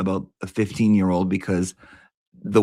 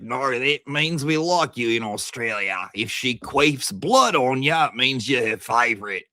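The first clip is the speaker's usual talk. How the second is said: mock Aussie accent; 3 semitones higher than usual. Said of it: "it's like".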